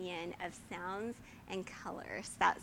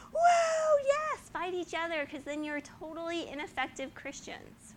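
high, gleeful mock devil voice; the enemy cheering Christians fighting, high and gleeful; 8 semitones higher, 4 dB louder than usual. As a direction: gloat like a delighted villain egging them on. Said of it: "you know what the enemy is".

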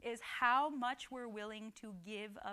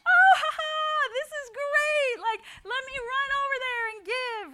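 hammed-up gleeful cry, very high and loud; over-the-top laughing shout of joy; hilarious giving; 14 semitones higher, 15 dB louder than usual. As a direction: whoop with mock hysterical delight, stretching the words. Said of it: "do we hilariously give? Were you like".

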